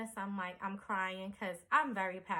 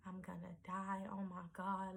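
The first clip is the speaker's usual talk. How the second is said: whispered terrified "I'm gonna die"; hushed and scared, barely voiced; frightened murmur under her breath; panicked whisper; 9 dB quieter than usual. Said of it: "this is what I'm saying".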